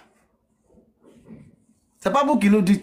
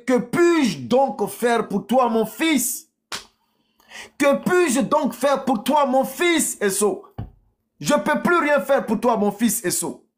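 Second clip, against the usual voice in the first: high, pleading father; acted anguish of a powerless father; helpless Isaac; 6 semitones higher, slower than usual. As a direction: implore the son in a raised voice. Voices Isaac helpless before Esau.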